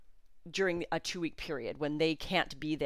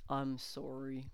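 quiet, slow, softer mumbled sorry; soft apology; 4 semitones lower, 9 dB quieter, much slower than usual. Retold or imitated. Husband slips into the sauna to apologize, quiet and slow. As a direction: let it out low and sheepish, slowly.

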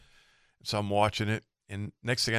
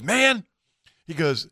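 exasperated lost boater; 7 semitones higher, 3 dB louder than usual. Retold or imitated. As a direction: imitate a boater hailing for help, pitched way up. Retelling lost boater hailing him.

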